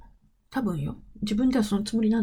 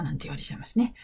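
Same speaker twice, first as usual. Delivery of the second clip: ordinary talk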